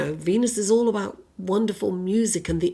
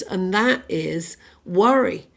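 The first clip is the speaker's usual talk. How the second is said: ordinary talk, nothing marked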